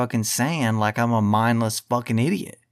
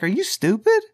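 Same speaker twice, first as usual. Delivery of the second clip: higher, incredulous mock yell at Rogan; imagined retort; 7 semitones higher than usual. What he would say to Rogan, incredulous mock yell. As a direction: snap it at him like a scolding. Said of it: "I'd be like".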